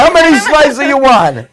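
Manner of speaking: put-on high pizzeria Italian accent. Host plays pizza place counter man, Italian accent. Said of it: "Walk into a pizza place and you're like".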